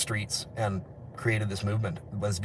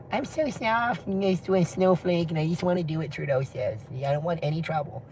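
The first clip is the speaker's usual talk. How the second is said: mocking soy boy; 5 semitones higher, 4 dB quieter than usual. Mocking the meek soy boy for the viewers in a soft high voice. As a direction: play a timid pushover, softly. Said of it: "mocking the soy boy".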